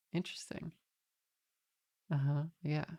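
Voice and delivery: very soft, withdrawn murmur; noncommittal reply. Retold or imitated. Passenger humouring the driver's Trump talk, soft noncommittal reply. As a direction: give a tiny, guarded acknowledgement, barely voiced.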